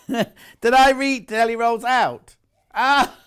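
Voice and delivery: laughing at own mistake; giggling while asking, slowed. Real, not acted.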